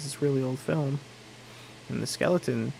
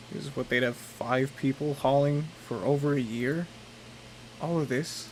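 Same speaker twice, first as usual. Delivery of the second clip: put-on southern accent; 4 dB quieter than usual. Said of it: "I keep forgetting how to do a southern accent".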